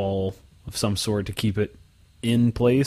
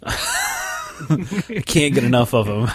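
laughing; 3 semitones higher, much slower than usual. Real, not acted. Sarcastic reply, laughing.

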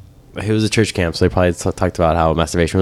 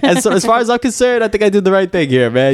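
pitched way up, defending himself to God; high squeaky mock defense; high mock plea. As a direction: whine your excuse. Continuing God bit.

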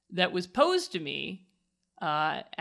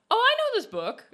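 put-on child voice; 5 dB louder than usual. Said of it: "She went".

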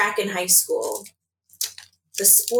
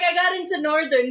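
laughing while quoting; 9 semitones higher than usual. Retold or imitated. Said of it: "I was like".

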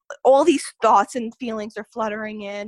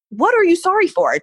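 loud, high, frantic demand; retold exasperated outburst, pitched way up; out of her mind; 10 semitones higher, 9 dB louder than usual. Frantic patient to the sonogram tech, loud and exasperated. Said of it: "Trying not to be rude to this lady, but I was so just out of my mind".

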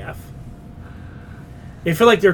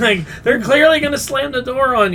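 laughing; 9 semitones higher than usual. Real, not acted. Laughing after yelling at TV.

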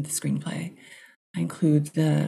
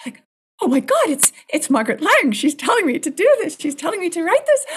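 high, loud, fast retold freak-out; 12 semitones higher, 6 dB louder, faster than usual. Writer alone sure the ghost signalled her, freaking out excitedly. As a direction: let astonishment race up in pitch. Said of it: "had a complete freak out".

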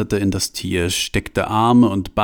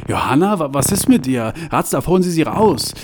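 panicked; 8 semitones higher than usual. Acted. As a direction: stammer in alarm, then demand. Franz panics as Johanna screams.

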